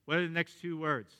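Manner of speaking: usual speaking voice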